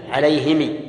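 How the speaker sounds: "عليهم" read as ʕalayhimi, i after both h and m; third reading. Shown as a demonstration. Teacher shows students the third reading of "عليهم". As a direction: say "عليهم" with kasra on both ha and mim. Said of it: "الثالث ... وكسرهما جميعًا".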